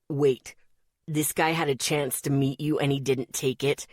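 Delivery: low, wary questioning; suspicious. Acted. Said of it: "his voice suspicious".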